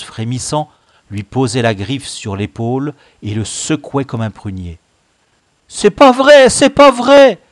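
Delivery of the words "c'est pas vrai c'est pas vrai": high, loud frantic denial; protesting. A narrator's reading. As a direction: cry out in protest, accused. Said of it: "protestait de nouveau Baccaillé". (protester à grands cris aigus)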